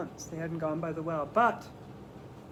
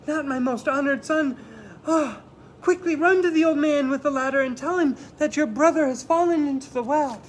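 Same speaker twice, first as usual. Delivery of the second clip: alarmed mother; 8 semitones higher, 6 dB louder than usual. Acted. Mother alarmed, sending Chang for help.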